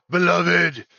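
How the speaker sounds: loud, high, slow Etten voice; giant voice. Acted. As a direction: call out as the lovesick giant.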